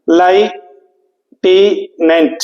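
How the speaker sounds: लाइटेनेंट very slowly as lie, tee, nent; spelling memory pronunciation. Shown as a demonstration. Teacher giving a memory trick for spelling, syllable by syllable. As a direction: break लाइटेनेंट into three well-separated chunks, first like "lie". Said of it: "spelling को ऐसे याद करते हैं".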